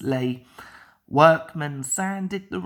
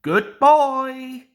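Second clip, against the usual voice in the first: higher, louder call; 8 semitones higher, 7 dB louder than usual. A narrator's reading. Toby calling encouragement to Mavis. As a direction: call out across the yard. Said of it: "called Toby".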